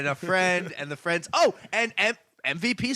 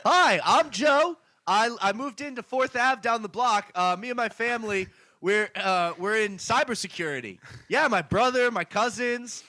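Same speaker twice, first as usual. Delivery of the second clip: put-on upbeat Korean-accented neighbour voice; 5 semitones higher than usual. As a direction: introduce yourself with a Korean accent. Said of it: "Billy doing an impression of a Korean man".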